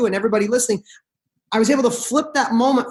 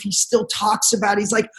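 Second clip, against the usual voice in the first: ordinary delivery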